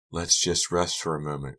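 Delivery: usual speaking voice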